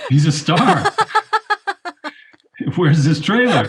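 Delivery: joking protest, laughing; laughing mock indignation. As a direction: play the outraged agent through giggles. Joking along, laughing at droid's mistreatment.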